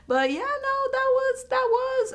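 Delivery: high squealing stammer; squeal, lost for words. Real, not acted. Viewer gushing over a vintage-looking suitor, high squeal.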